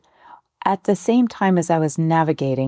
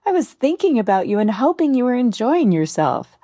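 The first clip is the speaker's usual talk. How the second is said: higher-pitched, lilting friendly voice; friend's eager question; 6 semitones higher than usual. Retold or imitated. New friend asking about the trip on a call, higher and friendly. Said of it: "my new friend asked on our call".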